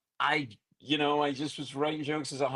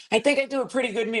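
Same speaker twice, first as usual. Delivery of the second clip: Leno impression; 9 semitones higher, 4 dB louder, faster than usual. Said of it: "And he's like".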